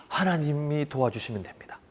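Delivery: soft, hushed words of faith; quiet trust. Retold or imitated. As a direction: say it softly as a believer. People's new trust voiced. (조용하고 나직한 믿음의 고백)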